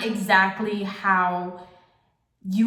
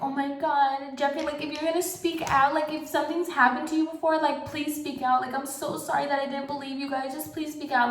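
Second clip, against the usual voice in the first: mocking Trisha; 6 semitones higher than usual. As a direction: parody a fake, teary plea. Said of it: "back to Trisha".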